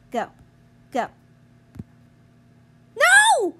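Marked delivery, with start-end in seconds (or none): 3.0-3.5 high, loud cry of dismay; shocked shout. Real, not acted. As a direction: scream it as you fail. Sudden loss in game.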